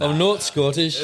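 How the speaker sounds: bad Scottish accent; mock Scottish accent, laughing. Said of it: "That's a terrible Scottish accent".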